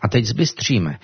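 ordinary talk

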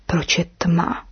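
slow whisper in the dark; hushed, puzzled question; bewildered whisper. A narrator's reading. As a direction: whisper it slowly, just woken and confused. Snake wakes confused in the dark.

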